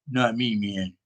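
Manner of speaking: low, soft, deflated stoner; kinda depressed. Retold or imitated. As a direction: imitate a friend quietly bowing out. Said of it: "He was kinda depressed".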